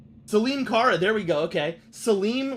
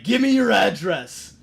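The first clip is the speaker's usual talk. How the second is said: higher, louder mock threat; mock angry troll; 4 semitones higher, 7 dB louder than usual. Retelling how angry chat users threatened him, hammed-up. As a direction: ham up a chat bully demanding. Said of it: "people would be just like".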